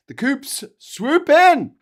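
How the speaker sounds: high, loud, slow headline boom; hammed-up headline. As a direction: announce the pun like a tabloid crier. Hams up punny headline.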